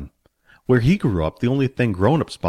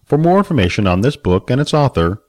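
louder, clear closing announcement; audiobook outro; 5 dB louder than usual. Acted. Narrator thanking listeners of Billy Barbarian, announcer read.

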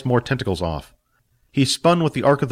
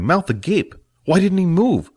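usual speaking voice

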